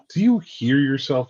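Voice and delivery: ordinary delivery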